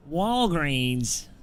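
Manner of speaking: mock American accent. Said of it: "say in a really good American accent".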